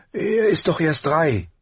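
high-pitched motherly protest; protective mother. A narrator's reading. Wife defends three-year-old to husband, high protective mother's voice. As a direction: plead the small child's case in a high voice.

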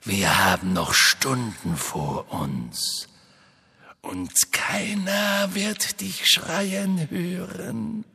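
cold menacing villain, shrieking; Voldemort voice. A narrator's reading. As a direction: taunt him high and cruel. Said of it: "sagte die kalte Stimme".

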